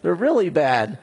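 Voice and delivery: the king half-joking at the bad news; mock dismay. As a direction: play Hezekiah half-joking that it's bad. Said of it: "He's like".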